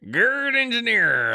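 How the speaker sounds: high, loud, drawn-out name; hammed-up name announcement. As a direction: stretch out the name like a showy announcer. Repeats donor name theatrically.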